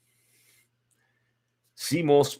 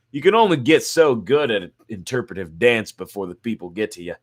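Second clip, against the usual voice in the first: ordinary delivery, nothing marked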